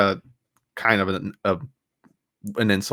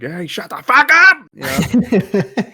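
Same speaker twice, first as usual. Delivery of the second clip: shouted high and loud, furious; shouting at a girl on the bus; yelling at her; 10 semitones higher, 4 dB louder, slower than usual. Retold or imitated. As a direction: blast it at the annoying girl. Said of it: "I was like".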